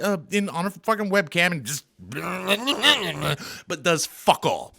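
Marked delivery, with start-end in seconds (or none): 2.0-3.6 wordless babble in mimicry; sputtered mock blabber; mock babbling. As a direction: blabber nonsense noises to mock her. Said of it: "babbles about some stuff".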